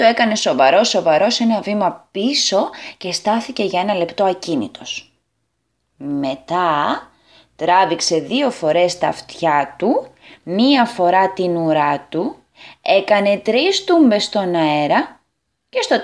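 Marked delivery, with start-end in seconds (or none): none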